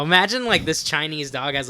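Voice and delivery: ordinary talk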